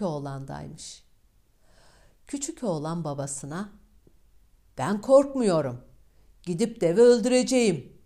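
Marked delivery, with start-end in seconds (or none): 4.8-7.9 loud and fast, higher as it goes; brave boast. Acted. Youngest son boldly telling his father he is not afraid. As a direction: fearless boy rushing out his vow.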